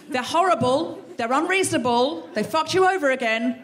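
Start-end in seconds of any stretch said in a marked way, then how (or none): none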